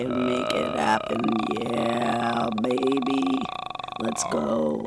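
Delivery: low creaky fry, slow; vocal fry. Shown as a demonstration. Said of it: "Put on the vocal fry".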